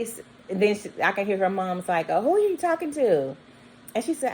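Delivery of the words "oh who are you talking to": a high put-on voice for the mom; suspicious mom. Mom asking her little girl who is on the phone, high voice. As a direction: mom calling out, wary of the caller.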